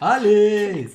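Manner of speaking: allez crié. Real, not acted. Se lance dans son coup.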